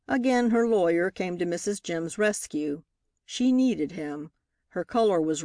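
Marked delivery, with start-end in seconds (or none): none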